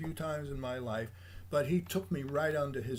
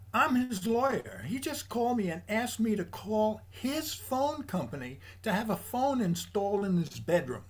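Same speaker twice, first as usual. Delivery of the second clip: imitating an incredulous lawyer, higher and louder; exasperated lawyer; 8 semitones higher, 5 dB louder than usual. Lawyer venting to young assistant about a client, imitated incredulous and higher.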